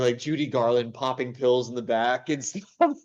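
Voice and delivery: ordinary talk, nothing marked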